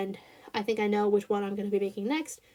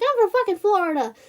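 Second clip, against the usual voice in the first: yelled, higher quote; loud retort; 9 semitones higher, 5 dB louder than usual. Retold or imitated. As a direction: shout it back indignantly. Quoting Val's retort.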